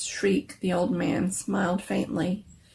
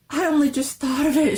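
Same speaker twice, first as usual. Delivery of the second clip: high, loud and frantic; gushing near hysteria; hysterical; 8 semitones higher, 6 dB louder than usual. A narrator's reading. Wife telling her husband her idea, hysterical. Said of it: "she said hysterically".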